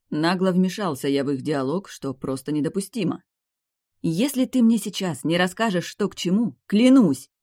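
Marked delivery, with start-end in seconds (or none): 4.0-7.3 raised, indignant pitch building to a loud oath; threatening. A narrator's reading. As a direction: warn them hotly, punch the final word. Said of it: "сверкнула взглядом Демия".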